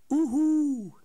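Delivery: loud high hooting owl call. A narrator's reading. Snow owl hooting over the plain, loud hoot. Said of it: "riep de sneeuwuil".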